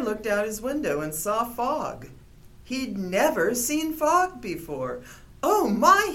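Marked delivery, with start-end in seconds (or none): 5.4-6.1 high, loud delighted gasp of wonder; excited cry. A narrator's reading. Bear sees fog and cries out excitedly. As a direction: cry out in amazement. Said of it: "he cried".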